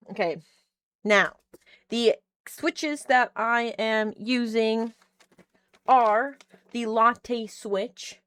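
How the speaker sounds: slow product showcase. Real, not acted. Presenting the keyboard switches.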